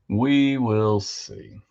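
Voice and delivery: slow drawn-out. Pretend. Repeats line in slow voice.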